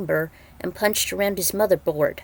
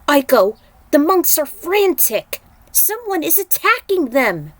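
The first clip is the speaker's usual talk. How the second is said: much higher, louder alarmed call; alarmed cry; 7 semitones higher, 5 dB louder than usual. Acted. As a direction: sound the alarm about the attack. Kenshi alarmed by frantic monks.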